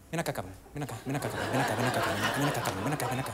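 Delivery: low hiss of an annoyed mom; hushed parental threat. Retold or imitated. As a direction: call the kid over quietly so nobody else hears. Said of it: "Esa famosa de".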